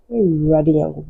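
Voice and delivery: usual speaking voice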